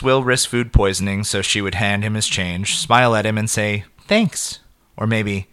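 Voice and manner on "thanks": light high cashier voice; girl's thanks. A narrator's reading. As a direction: chirp it like a friendly cashier. Girl thanks customer at counter.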